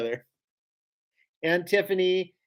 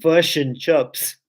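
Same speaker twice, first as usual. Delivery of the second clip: slow, loud Kiwi vowels, fush and chups; mock New Zealand accent; 5 dB louder, much slower than usual. Shown as a demonstration. Showing how Kiwis sound, thick Kiwi accent. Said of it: "I can do a good Kiwi accent".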